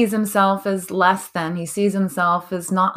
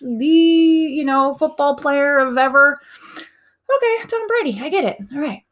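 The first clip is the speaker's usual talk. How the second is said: gushing; 8 semitones higher than usual. Retold or imitated. Mom gushing over Tom Brady.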